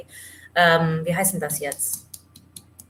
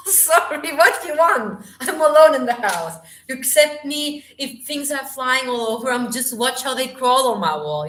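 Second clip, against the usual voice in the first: high, laughing, worked-up protest; real squealy fluster at a bug; flustered by an insect; 6 semitones higher, 4 dB louder than usual. Real, not acted. Startled by an insect, alone at home.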